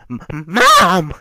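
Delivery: stammered, high, loud cry of panic. Acted. Villain sees his mother pierced in battle, panicked cry. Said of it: "he's freaking out like".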